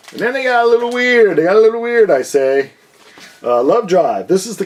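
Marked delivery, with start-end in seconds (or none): 0.1-1.4 high, loud, eerie sing-song; a hammed-up haunted-house voice; mock weird voice. Mock-spooky aside on weird covers. 1.4-2.1 high, loud, eerie sing-song; a hammed-up haunted-house voice; mock weird voice. Repeats in same spooky voice.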